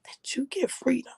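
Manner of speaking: hushed and airy; hushed emphasis. Real, not acted. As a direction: let it out softly, almost whispering. Repeats key line, moved.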